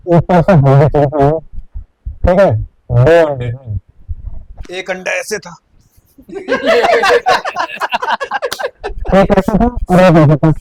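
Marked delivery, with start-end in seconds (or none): 6.2-9.1 bursts out in a high laugh; loud laughing fit at the memory; high amused burst of laughter. Real, not acted. Laughing at egg description.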